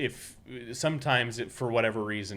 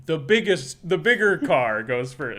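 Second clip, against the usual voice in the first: mock classmate; 8 semitones higher, 10 dB louder than usual. Classmate's answer voiced.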